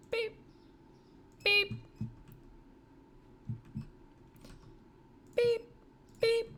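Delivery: high, loud mouth beep. Retold or imitated. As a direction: beep like a scanner. Imitating scanner beep.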